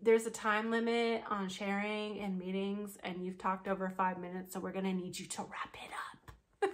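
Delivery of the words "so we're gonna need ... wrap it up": whispered, unvoiced aside; hushed mock cut-off of a long share. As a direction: whisper the brush-off. Cutting off the share.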